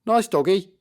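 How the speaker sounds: high, loud placating "Nice doggy"; nervous. Acted. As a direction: soothe a scary dog, nervously. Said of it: "said Bobby nervously".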